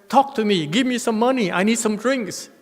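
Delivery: loud, high pestering drunk, lightly voiced; drunk beggar. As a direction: nag like a drunk asking for money. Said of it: "Taiwanese drunk".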